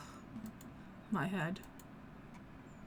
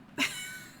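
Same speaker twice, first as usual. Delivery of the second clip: high villain laugh. Acted.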